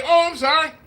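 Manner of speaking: high, airy fake sorry; mock apology. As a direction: toss off a hollow apology. Mock apology quoted repeatedly.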